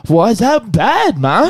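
put-on curious kid. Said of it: "I was like".

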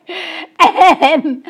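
loud, high burst of laughter; a real belly laugh at the memory; big laugh. Real, not acted. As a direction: laugh it out hard. Laughs after telling the story.

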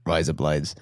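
low Australian drawl; a mock Australian line; Australian accent. Shown as a demonstration. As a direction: show off the practiced Australian accent. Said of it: "It sounds something like this".

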